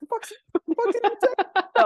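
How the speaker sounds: loud, high, slow mock bewilderment; high-pitched incredulous reaction; baffled disbelief. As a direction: blurt it out in comic outrage. Elephant and arbitrator react in disbelief.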